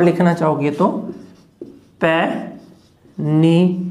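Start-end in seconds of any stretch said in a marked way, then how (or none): 2.0-3.8 panic stretched very slowly, each sound held; slow teacher demo of panic; slow model word. Shown as a demonstration. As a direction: draw out panic for the class. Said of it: "देखो panic".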